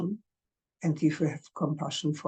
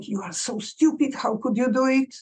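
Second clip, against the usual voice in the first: higher, scolding yourself; harsh self-criticism; 7 semitones higher than usual. Retold or imitated. Inner critic scolding oneself for a mistake, harsh.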